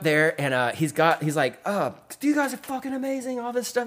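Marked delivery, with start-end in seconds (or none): 1.7-3.9 much higher mock British gushing; a light British-accent impression of a thrilled fan; hint of a British accent, pitched up with delight. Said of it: "He's talking in his British accent".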